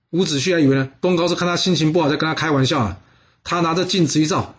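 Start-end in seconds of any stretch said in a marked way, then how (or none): none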